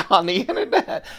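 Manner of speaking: laughing through the words. Real, not acted. Knife vlogger joking with his viewers, laughing.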